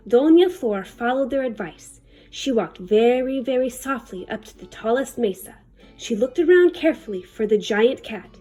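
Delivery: ordinary delivery, nothing marked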